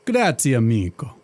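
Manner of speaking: put-on thick comic Italian genie accent; a jolly Italian genie, hammed-up accent. Genie chatting to his master in a hammed-up Italian accent.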